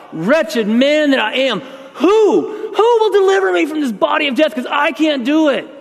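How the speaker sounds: desperate cry, high and loud, slow at first; wailing desperate plea. Acted. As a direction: plead it out in anguish. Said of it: "of crying out".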